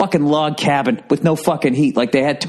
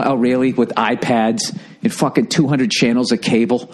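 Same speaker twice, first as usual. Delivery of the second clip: ordinary talk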